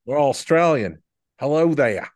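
hint of a jokey Australian accent; mock Australian accent. Host joining the bit, mock Australian accent. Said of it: "Australian accent".

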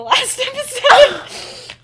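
real laugh through the words; giggling mid-sentence. Real, not acted.

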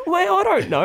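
high, loud bewildered reply; a baffled mate pleading ignorance; helpless bloke. Retold or imitated. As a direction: throw up your hands in your voice. Himself, retold.

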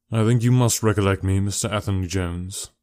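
ordinary delivery

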